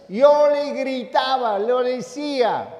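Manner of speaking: voz alta, aguda y alargada; gritos imitados. Retold or imitated. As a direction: revive los gritos de una esposa, alargando las palabras. Revive los gritos de la esposa. (imitando a una esposa que grita)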